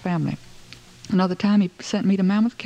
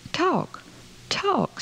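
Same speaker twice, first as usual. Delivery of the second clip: 1 emphatic; 11 semitones higher than usual. A narrator's reading. Mama urging over stairs.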